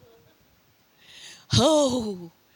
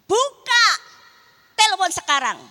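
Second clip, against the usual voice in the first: louder, higher commanding voice of God; God's command; 6 semitones higher, 11 dB louder than usual. Retold or imitated. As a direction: order her to open it now. (perintah Tuhan yang keras)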